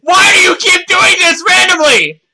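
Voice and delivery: high exasperated yell; loud annoyed cry; frustrated shout. Real, not acted. GM frustrated at the additions.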